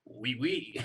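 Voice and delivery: put-on French "oui, oui"; game-show French voice; French accent. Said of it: "In a French accent".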